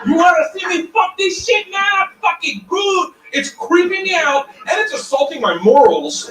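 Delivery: high-pitched mock yell on stage; mock shouting. Comic retelling a creepy live show, mock shouting. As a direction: yell it high over a rowdy room.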